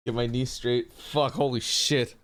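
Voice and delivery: ordinary delivery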